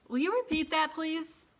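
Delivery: high, prim clerk voice; high officious voice asking again; fussy phone clerk. A narrator's reading. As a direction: sound like a puzzled clerk on the line.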